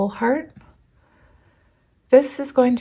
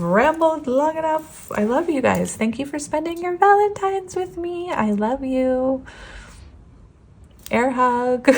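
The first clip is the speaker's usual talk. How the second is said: higher, sweet and fond; affectionate sign-off; 7 semitones higher than usual. Real, not acted. Reader thanking viewers for Valentine's, high and fond. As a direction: gush love to your viewers.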